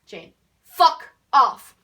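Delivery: slow, very loud, punched words; exaggerated shout. Turning round to Jane who keeps following, exaggerated shout. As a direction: overblown bellow, each word on its own. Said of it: "But I didn't say it like that".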